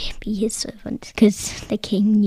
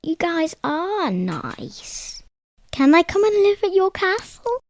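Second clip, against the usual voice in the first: king's plea; 8 semitones higher than usual. Acted. King asks friends to join.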